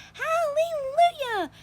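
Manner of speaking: very high, loud, slow exultant cry; joyful shout. A narrator's reading. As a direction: crow it out in triumph. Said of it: "Aunt Spiker shouted".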